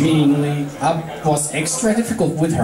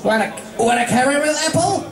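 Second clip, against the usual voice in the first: fast, higher, half-laughing offer; hurried tomboy pony; 12 semitones higher, faster than usual. Acted. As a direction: rush the offer with a chuckle. Rainbow offers apple.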